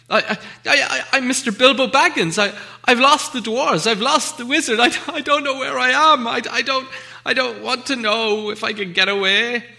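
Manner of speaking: lost hobbit stammers to the creature in the dark; stammering fright. Acted. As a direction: stumble over every word in panic.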